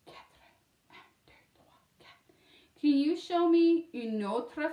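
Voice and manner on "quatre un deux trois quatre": very soft, voiceless whisper; whispered counting. Real, not acted. Teacher showing four to children, whispered count. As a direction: count along under your breath. Counting along softly on fingers.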